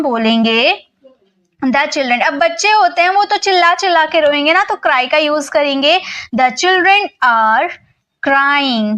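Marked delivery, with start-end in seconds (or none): 6.4-8.9 slow, word by word, like dictating to a class; patient teacher dictating slowly; slow dictation. Shown as a demonstration. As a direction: spell it out slowly so students can write it down. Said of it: "तो आप कैसे बोलोगे?".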